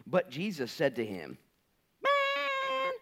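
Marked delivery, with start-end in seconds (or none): 2.0-2.9 very high, drawn-out exasperated sigh word; exasperated man. Retold or imitated. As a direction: stretch it up high in disbelief. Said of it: "I think that's how he said it".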